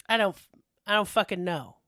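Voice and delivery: ordinary talk, nothing marked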